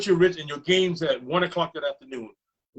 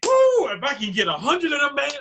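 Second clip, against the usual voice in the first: high, excited gushing as the retold coach; 11 semitones higher, 4 dB louder than usual. Retelling the coach's reaction to hearing about Nick, excited. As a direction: imitate a coach gushing, pitch way up. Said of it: "I got so excited".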